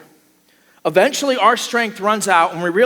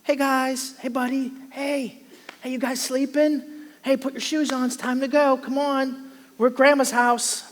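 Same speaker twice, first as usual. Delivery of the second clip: gentle wake-up; 7 semitones higher than usual. Shown as a demonstration. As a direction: coax sleepy kids to get up. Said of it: "Where do you suppose the inflection was".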